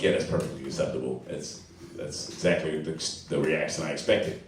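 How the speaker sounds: sarcastic mock approval, low; deadpan sarcasm. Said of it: "And I'm like".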